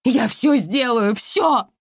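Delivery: high, loud desperate wail. Acted. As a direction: wail it, begging. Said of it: "вопила".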